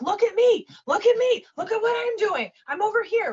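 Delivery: high, insistent mock-kid call for attention; attention-seeking kid. Coach imitating a small child begging to be watched, high and insistent. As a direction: imitate a needy child, pitched high and loud. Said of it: "the kid's constantly like".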